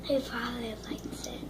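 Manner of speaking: soft unvoiced aside; whispering. Real, not acted. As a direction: whisper it so he won't hear. Aside while he eats.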